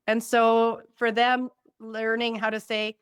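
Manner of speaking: ordinary delivery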